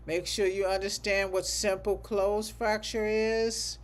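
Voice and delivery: normal talking voice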